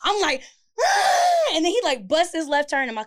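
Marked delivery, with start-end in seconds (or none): 0.8-1.5 much higher and louder, raging scream; furious scream. Retold or imitated. Chasing the boyfriend down the road, a raging scream. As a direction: let out a wild yell of rage.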